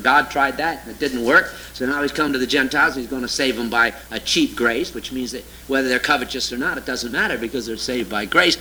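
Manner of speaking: a scornful low-voiced send-up of a doctrine he rejects; mocking parody. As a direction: drop the voice low to parody their cheap-grace teaching.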